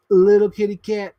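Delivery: mock-cute little kitty cat; cutesy kitty voice. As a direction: coo it like talking to a kitten. Playful repeats of a phrase.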